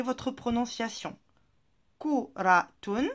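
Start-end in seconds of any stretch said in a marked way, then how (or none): 2.0-3.1 "Kuratun" drawn out slowly in one breath; "Kuratun" spoken smoothly and carefully, teacher model; slow model word. Shown as a demonstration. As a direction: say "Kuratun" slowly for pupils to repeat. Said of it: "répéter après moi".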